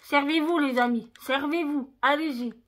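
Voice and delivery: bright, loud, playful hosting; mock-generous hostess inviting viewers to help themselves; playful loud offer. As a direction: call out cheerfully as if passing the box around. Offering chocolates to viewers on camera.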